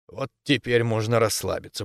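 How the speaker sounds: usual speaking voice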